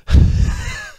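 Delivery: a sudden high-pitched loud cackle; high laugh. Real, not acted. Burst of laughter at traders.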